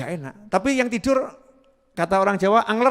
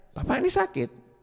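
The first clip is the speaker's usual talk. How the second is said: puzzled visitor; 12 semitones higher, 6 dB quieter than usual. Retold or imitated.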